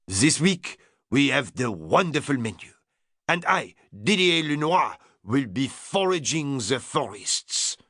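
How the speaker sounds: put-on strong French accent, proud and grand; French chef. French chef boasting his menu to Lord Errington, strong French accent.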